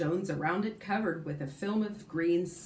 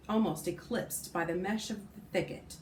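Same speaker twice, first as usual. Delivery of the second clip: usual speaking voice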